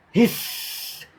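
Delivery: long unvoiced hissing S; sustained hiss. Shown as a demonstration. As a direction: breathe out a long steady hiss. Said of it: "It is great for S sound".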